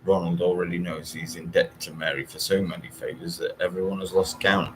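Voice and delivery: plain reading voice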